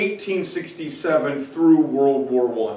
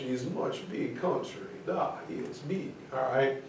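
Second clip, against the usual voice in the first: softer mock Russian accent with broken English; 4 semitones lower, 14 dB quieter than usual. Shown as a demonstration. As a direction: quietly ham up a Russian voice. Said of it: "here again is Mother Russia".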